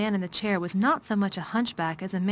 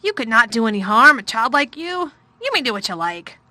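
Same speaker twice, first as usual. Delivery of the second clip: louder, weary commanding man; a tired, gloomy lord giving orders; gloomy master of house; 3 semitones higher, 10 dB louder than usual. A narrator's reading. Craven reassures.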